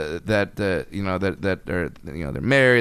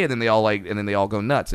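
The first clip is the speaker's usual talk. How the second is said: ordinary delivery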